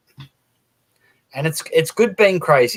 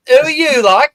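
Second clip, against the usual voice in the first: much higher, baffled "who are you?"; baffled local; 13 semitones higher, 7 dB louder than usual. Retold or imitated. Mock shopkeeper baffled by a newcomer's accent, much higher. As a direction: play the puzzled shopkeeper hearing a stranger. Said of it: "the bloke's got a different accent".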